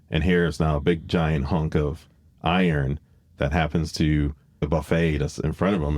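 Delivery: usual speaking voice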